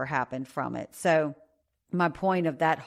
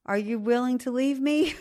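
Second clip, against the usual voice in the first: half-joking plea; 8 semitones higher than usual. Real, not acted. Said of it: "like, laughing, but serious".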